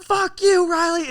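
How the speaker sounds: high, very loud parting shriek; mock furious screaming. Imitating sister before slamming her door, screaming. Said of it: "And slamming a door".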